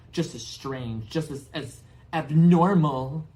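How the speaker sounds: high, loud, spiteful tirade; bitter aunt ranting. Acted. Aunt rants at the boy about her witch sister. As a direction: pour out years of resentment.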